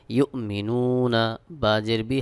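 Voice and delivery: ordinary talk, nothing marked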